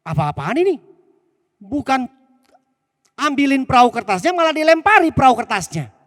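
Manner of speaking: put-on a kid furious that dad throws rocks; high, loud outraged little boy; upset child protesting. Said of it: "Anaknya kaget".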